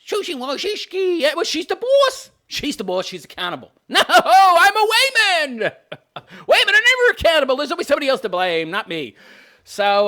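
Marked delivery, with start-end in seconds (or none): 0.0-2.3 high, silly ditz impression; mock Valley Girl lilt; ditzy voice. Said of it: "Ditzy voice". 3.9-7.6 high, loud mock wail; hammed-up high airhead protest; ditzy voice. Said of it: "Ditzy voice".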